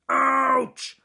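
high, loud yelp; bear's pained yelp. Acted. Bear leaps up and yelps, high and loud. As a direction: yelp on the sore foot. Bear hurts foot again.

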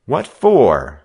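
bristling at the injustice; hot demand. A narrator's reading. Joe to the gang leader, loud hot demand. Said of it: "Joe demanded hotly".

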